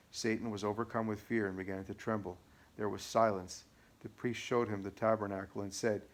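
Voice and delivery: ordinary delivery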